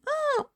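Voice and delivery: very high little oh; short high yelp on tripping; startled cry. Acted. Newton trips on a stone. (petit cri aigu de surprise)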